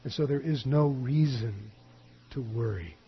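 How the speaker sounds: usual speaking voice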